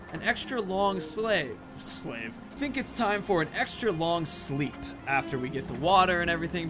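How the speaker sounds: usual speaking voice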